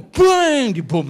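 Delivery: very high, loud mock bang; bomb blast. Preacher shows a home getting bombed, loud shouted bang.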